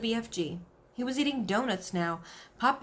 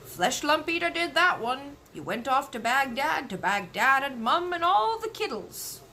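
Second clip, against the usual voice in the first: friendly giant voice; 5 semitones higher, 4 dB louder than usual. Acted. Giant explains to Queen.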